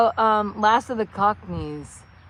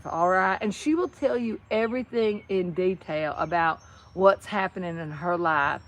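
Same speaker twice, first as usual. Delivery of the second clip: long-winded Southern drawl, slowed down. Shown as a demonstration. Describing chatty Betty Lee at church, slow Southern drawl. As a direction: mimic a leisurely Southern churchgoer, stretching words. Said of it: "some Southerners, they just have all the time".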